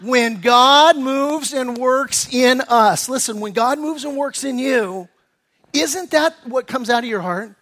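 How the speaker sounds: normal talking voice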